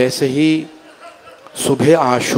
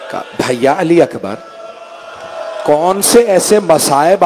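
high, rising mourning sermon voice; higher, grieving preacher's cry; lamenting recitation; 3 semitones higher than usual. Acted. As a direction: lift the lament, pleading with the gathering.

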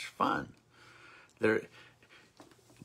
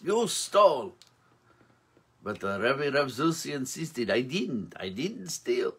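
put-on storyteller's Yiddish accent; 2 semitones higher than usual. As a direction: spin the old rebbe legend in a gentle Yiddish lilt. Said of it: "it's fun to do the accent".

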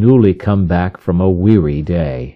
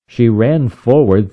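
ordinary delivery, nothing marked

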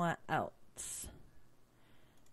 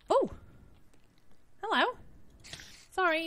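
normal talking voice

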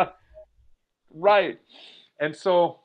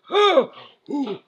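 short high mock groans; imitated gasping recovery; 10 semitones higher, 4 dB louder than usual. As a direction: mimic getting breath back after a fall. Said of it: "she goes".

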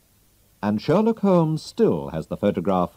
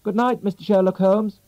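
put-on young man's voice; 11 semitones higher, 3 dB louder than usual. Disguised young man greets Holmes.

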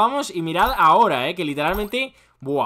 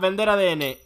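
ordinary delivery

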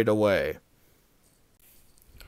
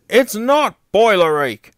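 higher, louder indignant denial; put-out objection with raised pitch; protesting; 8 semitones higher, 6 dB louder than usual. A narrator's reading. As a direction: protest against the diagnosis. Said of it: "protested Gordon".

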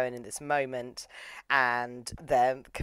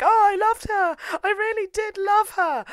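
very high, loud, shrill-pitched mock wail; hysterical wailing Yuki; 6 dB louder than usual. Yuki wailing over his lost love in a hammed-up falsetto. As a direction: wail it out like a hysterical heartbroken lover. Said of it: "starts screaming".

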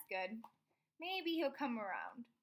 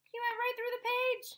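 much higher, louder excited reveal; 10 semitones higher, 4 dB louder than usual. A narrator's reading. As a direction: tell it like a thrilling surprise. Excited reveal after sound effect.

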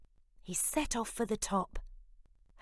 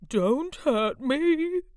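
high, slow, loud frightened whimper; a big lion begging, scared; 7 semitones higher, 10 dB louder, slower than usual. Acted. Frightened lion pleading with the mouse, whimpering. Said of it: "he whimpered".

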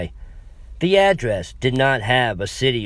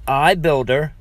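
clerk mimics her broken English, lightly; 4 semitones higher, 4 dB louder than usual. Clerk unthinkingly echoes the woman's broken English. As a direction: talk like her without meaning to. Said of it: "heard what I just said".

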